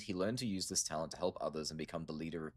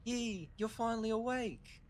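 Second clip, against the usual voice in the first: much higher put-on girl's voice; high girlish voice. Shun Shun relieved Yi is awake, high girlish voice. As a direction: lift way up into a young girl's voice.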